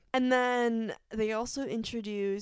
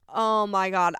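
loud burst of oh my God; excited; 8 dB louder than usual. Real, not acted. Fan recalling the reveal, loud burst. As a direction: blurt it out, overwhelmed.

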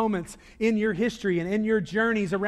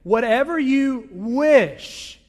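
slow, drawn out; 3 semitones higher, 5 dB louder, much slower than usual. Shown as a demonstration. Preacher leading the congregation, slow and deliberate. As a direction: say it slowly with the congregation. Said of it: "Say, say that with me".

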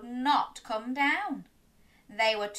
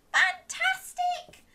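high delighted praise; slower than usual. Real, not acted. Praises children.

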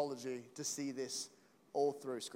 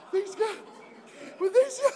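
much higher, louder; getting emotional, higher and louder; emotional squeak; 8 dB louder than usual. Real, not acted. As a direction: laugh it up. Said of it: "I'm really emotional".

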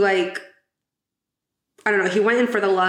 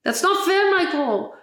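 much higher, pushed complaint falling on Michael; Toto doll catchphrase; 10 semitones higher than usual. Retold or imitated. Toto's talking doll complaining to Michael, mock indignant. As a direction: whine it like a talking doll toy. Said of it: "Toto's would be like".

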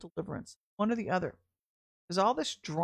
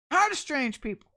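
mock exasperation; 5 semitones higher, 5 dB louder than usual. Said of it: "Sorry, I'm just kidding".

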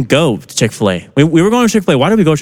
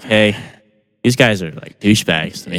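imitating Joe; 7 semitones lower, 4 dB quieter than usual.

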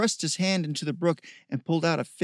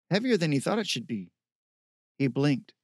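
usual speaking voice

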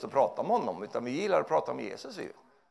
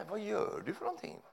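soft, higher, solemn quaint tone; mock old-fashioned pastor; 6 semitones higher, 8 dB quieter than usual. Preacher mimicking pastor's stilted old-fashioned speech, softly. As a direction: ask it in stilted churchy manner. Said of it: "Pratar så här".

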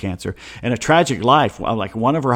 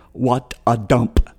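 slow, drawn-out movie-star quote; Bette Davis; much slower than usual. Retold or imitated. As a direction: lightly imitate a film star, word by word. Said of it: "As Betty Davis would say".

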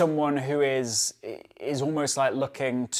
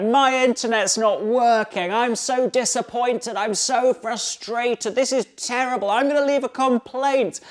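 much higher, louder petulant moaning; mock whining complaint; 8 semitones higher, 4 dB louder than usual. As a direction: whine like a spoiled passenger whose wifi failed. Passengers complaining about slow wifi.

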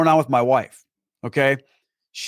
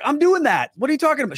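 higher, puzzled pushback at his own past self; mock indignant what are you talking about; incredulous retort; 10 semitones higher, faster than usual. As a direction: replay the disbelief, pitched up. Retelling his own puzzled reply.

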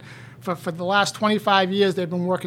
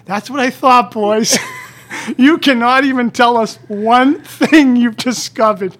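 loud, slow sarcastic putdown; loud mocking dismissal; 2 semitones higher, 9 dB louder, slower than usual. Loudly mocking the speechless doctors as he leaves.

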